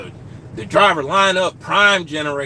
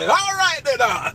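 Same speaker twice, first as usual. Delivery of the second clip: mock greeting while driving; 10 semitones higher than usual.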